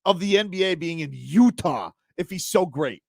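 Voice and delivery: high-pitched, indignant sports rant; worked-up rant. Real, not acted. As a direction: fire off outraged rhetorical questions. Heated rant defending Pat Riley.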